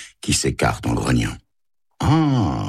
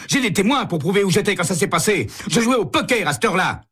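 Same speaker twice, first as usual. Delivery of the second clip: high, jittery suspect scrambling for an alibi; stammering cornered man talking fast; nervous suspect; faster than usual. Acted. Fischer backpedals nervously.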